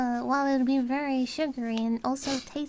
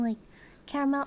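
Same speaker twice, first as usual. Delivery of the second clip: ordinary talk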